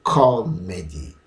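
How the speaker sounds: "Comedy" with a long, punched CO, falling after; first-syllable stress. Shown as a demonstration. Teacher showing how it goes, strong slow first syllable. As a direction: lean slowly on CO in "Comedy", then drop. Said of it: "So it goes like this".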